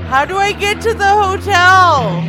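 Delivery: desperate, losing it on the phone; frantic yelling. Acted. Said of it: "so it sounds like you're frantic".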